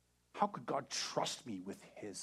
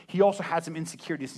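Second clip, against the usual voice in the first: ordinary talk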